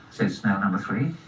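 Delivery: ordinary delivery, nothing marked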